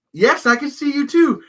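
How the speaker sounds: high, loud mock acknowledgment; loud, high sing-out at a starer; exasperated call-out. Calling back to the staring man.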